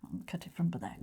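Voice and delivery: hushed, under the breath; very soft whisper while busy with a task; whispered aside. Real, not acted. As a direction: whisper it as a quick practical aside. Aside while cutting the pastry.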